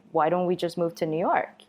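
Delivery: usual speaking voice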